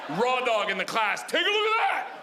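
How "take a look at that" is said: high, proud "Take a look at that!"; a shameless little girl crowing, pitched high; kid showing off. Retold or imitated. Daughter shows off to her preschool class, high and proud.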